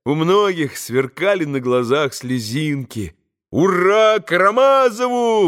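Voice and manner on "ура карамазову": high, loud hurrah; soaring, jubilant shout; rapturous cheer. A narrator's reading. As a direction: proclaim it like an elated boy. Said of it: "восторженно провозгласил Коля".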